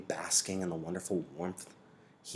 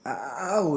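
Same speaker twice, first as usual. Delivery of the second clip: higher, louder pained ow; a wince turned into a word; short pained yelp; wincing ow; 8 semitones higher, 7 dB louder than usual. A narrator's reading. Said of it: "wincing at the shift".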